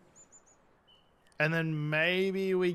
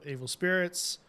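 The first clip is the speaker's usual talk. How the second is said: ordinary delivery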